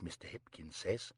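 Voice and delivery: ordinary delivery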